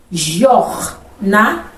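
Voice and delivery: Jornada stretched as jor-na-da, very slow; very slow syllables. Shown as a demonstration. Teacher slowly separating Jornada for students. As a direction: pull Jornada apart slowly. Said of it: "Três momentos de som que eu expiro".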